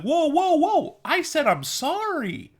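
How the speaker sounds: high, slow, put-upon whine; indignant mock apologizer. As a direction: play the wounded offender demanding forgiveness. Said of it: "it's like".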